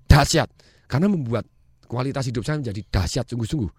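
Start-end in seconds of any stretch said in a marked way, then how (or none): 0.1-0.5 short burst, punched out loud; energetic answer. Shown as a demonstration. Speaker answering "how are you" with gusto, loud energetic reply. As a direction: answer with full conviction, louder. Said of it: "Apa kabar?" Jawaban saya".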